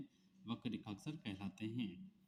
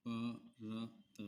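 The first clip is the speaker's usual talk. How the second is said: slow dictation; 4 dB quieter, much slower than usual. Shown as a demonstration. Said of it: "तो प रेखाक्षर ऊपर से नीचे".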